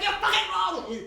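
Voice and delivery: high, loud mock yell at himself; furious yell. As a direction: scream it back at your own reflection. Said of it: "I'm like".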